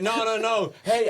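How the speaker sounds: hammed-up tipsy woman waving him off; insistent drunk fan. Tipsy fan insisting to the comic she saw him, high and loud. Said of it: "She's like".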